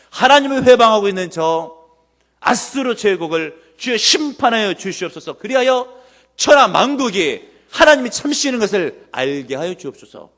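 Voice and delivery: earnest prayer. Retold or imitated. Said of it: "간절히 히스기야가 하나님께 기도합니다". (간절히 부르짖는 기도를 재연하듯)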